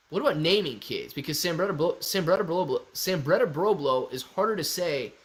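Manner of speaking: normal talking voice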